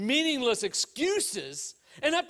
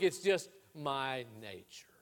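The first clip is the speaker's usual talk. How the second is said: low, slow, near-whispered admission; hushed confession; 10 semitones lower, 7 dB quieter than usual. Acted. Scorpion explaining itself to the drowning turtle, hushed confession. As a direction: drop right down and let it out slowly.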